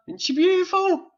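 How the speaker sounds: very high-pitched cooing; high, doting gush over a little one; gushing admiration. Real, not acted. Cooing over a guest's daughter.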